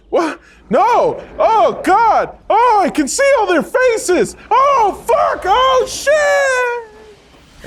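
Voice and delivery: very high, loud dying wails; terrified screaming. Acted. Dad disintegrates into nothing, terrified screaming. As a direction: scream in horror as you come apart.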